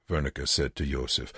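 ordinary delivery

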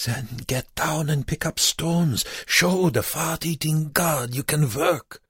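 put-on high-pitched accented urging; urgent order. A narrator's reading. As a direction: drive him to prove he can work. Wernicke urges prisoner to work.

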